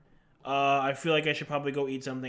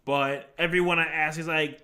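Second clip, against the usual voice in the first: usual speaking voice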